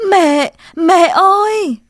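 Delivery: loud, high sobbing wail, slowed; crying out loud. Acted. Boy running home to his dead mother, sobbing wail. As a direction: wail for mother through tears. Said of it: "miệng la lớn".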